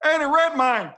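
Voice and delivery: mock outraged shout. Acts outraged American at British actors.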